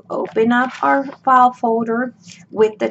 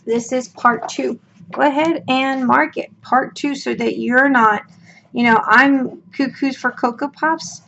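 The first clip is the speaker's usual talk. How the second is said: ordinary delivery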